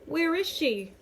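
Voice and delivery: high, loud demand. A narrator's reading. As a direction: demand it sharply. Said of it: "she demands".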